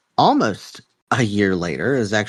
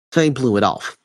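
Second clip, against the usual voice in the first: ordinary delivery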